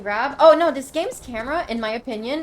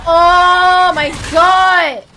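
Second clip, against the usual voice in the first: loud, slow, higher outcry of despair; drawn-out shout; 7 semitones higher, 13 dB louder, much slower than usual. Real, not acted. Player after wasting the last shield, drawn-out shout. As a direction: stretch it out in a long wail.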